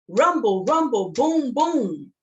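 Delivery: loud, punchy rumbling chant; elephant rumble chant. Shown as a demonstration. Storyteller teaching kids the elephant sound, loud. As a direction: stomp it out like a big elephant. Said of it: "Now you say it".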